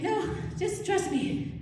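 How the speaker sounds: high, slow, insistent plea; Spider Grandma. Acted. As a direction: plead slowly in a loud, high little voice. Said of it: "She's like".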